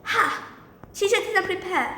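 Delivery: acted classroom heckle; mocking shout. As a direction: call it out high, as a retold shout. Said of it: "I shouted".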